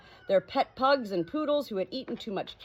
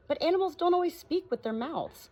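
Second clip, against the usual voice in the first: much higher, lilting parrot voice; 10 semitones higher than usual. Acted. Parrot correcting the doctor in a high voice. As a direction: lift it up high like a bird. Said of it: "said the parrot in a high voice".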